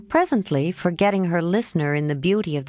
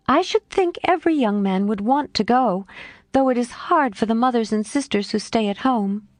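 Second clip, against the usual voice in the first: plain reading voice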